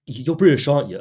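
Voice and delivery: imitating a pointed question. Customer checking up on the coffee seller, pointed. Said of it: "just the way he said it".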